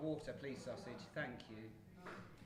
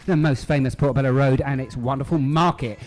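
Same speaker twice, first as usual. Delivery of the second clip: louder, upbeat show host intro; radio presenter; 24 dB louder than usual. Acted. Presenter welcomes listeners to the live show, upbeat host voice.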